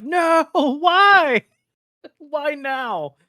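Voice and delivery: high, laughing cry of despair; anguished wail. Retold or imitated. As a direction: wail at the bad luck, laughing. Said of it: "you're like".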